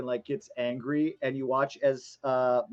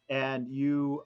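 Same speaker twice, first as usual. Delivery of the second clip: ordinary delivery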